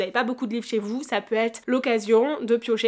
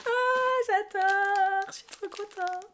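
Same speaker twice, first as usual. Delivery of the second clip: excited; 9 semitones higher, much slower than usual. Real, not acted. Thrilled by an item.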